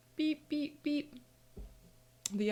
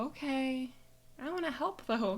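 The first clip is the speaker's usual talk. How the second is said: put-on eager little girl; 5 semitones higher than usual.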